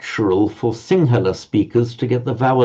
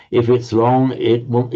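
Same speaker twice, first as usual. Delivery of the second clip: normal talking voice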